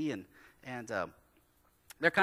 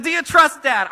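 "trust" punched much higher and louder; emphatic repeat; 17 dB louder than usual. Retold or imitated. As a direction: ask "Do you trust Dad?" again, now loud and bold. Dad asks kids twice.

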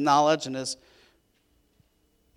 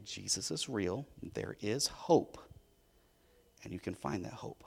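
gentle sincere message; 7 semitones lower, 13 dB quieter than usual. Acted. Carrying the message of hope.

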